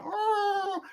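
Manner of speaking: high, drawn-out howl; mimicked cry of pain. Retelling the brother's reaction to the kick, mimicked high cry. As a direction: imitate a kid wailing, long and high.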